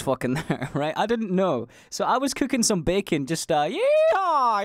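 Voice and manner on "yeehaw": high, slow hammed-up yeehaw; mock cowboy whoop. Cheering himself while cooking bacon.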